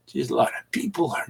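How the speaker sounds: fast soft whisper; whispered aside. Real, not acted. Host noticing the crowded stream, quick whispered aside. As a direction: murmur it to yourself, awed.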